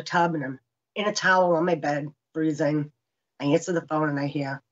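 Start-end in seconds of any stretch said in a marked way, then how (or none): none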